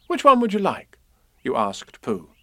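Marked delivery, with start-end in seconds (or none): 0.1-1.0 put-on high, louder young voice; boy's question. Boy asking bear to choose a balloon, high and eager. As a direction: offer the choice high and eager.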